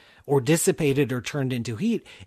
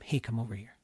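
soft low repeated beckoning; quiet coaxing; 6 semitones lower, 9 dB quieter, faster than usual. Pretend. Jupiter endlessly luring Earth, soft coaxing. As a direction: murmur the call over and over. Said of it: "we're hearing that whisper all the time".

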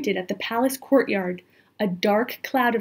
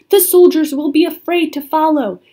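raised, loud urging; urgent instruction; 5 semitones higher, 8 dB louder than usual. A narrator's reading. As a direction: call out advice as they flee. Scholar urgently reassures fleeing children.

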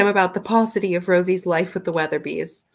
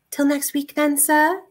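higher-pitched farewell to a gentleman; lightly voiced Rosie; 8 semitones higher, 4 dB louder than usual. Rosie parting from sir till next week, lightly voiced higher. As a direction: lift the voice lightly for her line.

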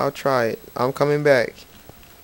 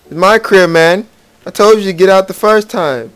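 louder, higher furious telling-off; angry scolding; 7 semitones higher, 8 dB louder than usual. Acted. Gamer yelling at a villager in his house, angry. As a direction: yell it like they ignored your warning.

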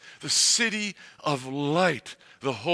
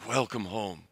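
tender welcome; 6 semitones lower, 6 dB quieter than usual. A narrator's reading. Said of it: "And he says".

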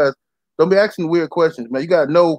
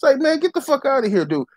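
much higher, scornful outburst; exasperated scoff; 8 semitones higher than usual. Retold or imitated. At curious buyers wanting a pitch, higher exasperated scoff. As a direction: scoff at the time-wasting buyer. Mock scolding tire kickers.

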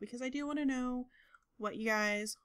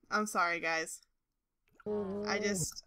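usual speaking voice

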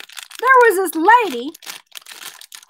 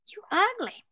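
higher, softer bird insult; talking parakeet; 4 semitones higher, 10 dB quieter than usual. Acted. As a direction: drop the insult quietly in bird voice. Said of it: "the bird says".

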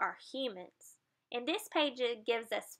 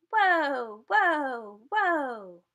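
louder drawn-out whoas, falling each time; spinning whoa; 3 semitones higher, 6 dB louder, much slower than usual. Acted. Reading to kids, dolphin spinning with dizzy whoas. Dolphins spin, sound effect.

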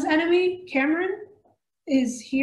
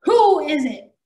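1 demanding; 4 semitones higher, 7 dB louder than usual. A narrator's reading. Villain demanding answer.

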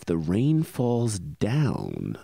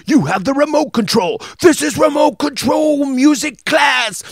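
shouted show title; 14 semitones higher, 8 dB louder than usual. Acted.

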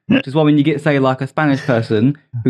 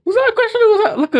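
mocking echo; faster than usual. Mocking a misheard question.

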